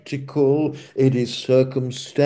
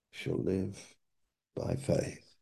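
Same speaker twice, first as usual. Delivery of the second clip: tailing off quietly; 5 semitones lower, 15 dB quieter than usual. Shown as a demonstration. Falls away after stressed words.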